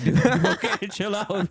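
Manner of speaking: high, laughing mock plea; laughing retort. Podcaster retelling his reply to the ticket guy, laughing through it. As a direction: crack up while telling him to calm down.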